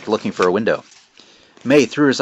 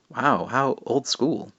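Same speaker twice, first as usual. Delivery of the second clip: sarcastic; 3 semitones higher, 4 dB quieter, much slower than usual. Acted. Sarcastic May.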